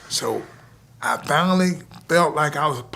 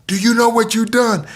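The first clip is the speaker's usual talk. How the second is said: higher, louder, thrilled coach; excited coach; 7 semitones higher, 5 dB louder than usual. Retold or imitated. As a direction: burst in amazed at the kid. Coach rushing in after knockout.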